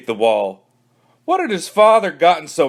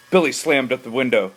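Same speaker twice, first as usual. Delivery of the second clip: plain reading voice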